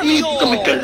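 put-on softly lisped kid voice; small child. Child pestering father with questions, lisping little-kid voice. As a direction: keep asking why, in baby talk. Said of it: "la clásica pregunta del niño".

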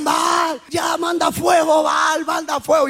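slow, drawn-out mock pleading chant; imitated priests' cries. Priests beg Baal for fire, mock chanted crying. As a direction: wail it like a desperate invocation, half-shouted. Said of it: "Los sacerdotes gritaban y gritaban".

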